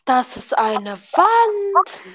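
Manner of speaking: parroting a friend in a slow, high sing-song; mocking repeat. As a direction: drag it out to mock him. Mocks friend's "sogenannte Wand.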